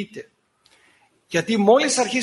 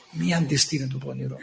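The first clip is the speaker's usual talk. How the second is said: solemn low; 7 semitones lower, 5 dB quieter than usual. Acted. Preacher repeating the scripture to his flock, low and solemn. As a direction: lower and softer, "Μη" held gravely.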